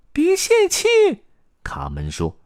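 very high, loud tiny-character voice. A narrator's reading. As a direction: cheer them on in a squeaky little voice. (尖细高亢的鼓励)

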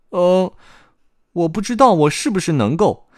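put-on high, loud child voice; hesitate, then answer in a high boyish voice; hesitant little boy. Boy unsure about becoming heir, hesitant child voice.

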